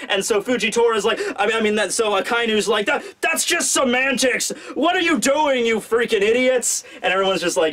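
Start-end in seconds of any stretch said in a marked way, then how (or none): 2.8-6.8 higher-pitched exasperated scolding, not truly shouted; mock yelling. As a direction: imitate an officer yelling at idiots. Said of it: "you know, he's yelling again".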